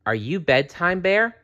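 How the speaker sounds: put-on a small girl's wondering question; child voice. A narrator's reading. Sally asking the bear at her bed, light child voice.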